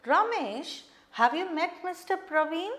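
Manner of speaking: demonstrated question tune, "Praveen" going up; questioning rise. Shown as a demonstration. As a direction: ask it so the tone climbs on "Praveen". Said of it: "your tone goes up".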